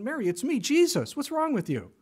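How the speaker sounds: lightly hammed-up impatient call; mock exasperated Jesus. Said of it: "didn't reveal himself ... going".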